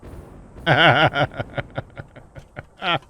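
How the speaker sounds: long burst of real laughter. Real, not acted. Gamer cracking up at chat, real laughter.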